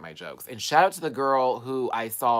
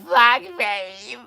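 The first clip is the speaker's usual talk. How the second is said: high, loud taunting call; really loud; 11 semitones higher, 9 dB louder than usual. Retold or imitated. Repeating the insult to the driver, loud and high. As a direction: holler it high, reenacting the insult. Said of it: "I just said it really loud".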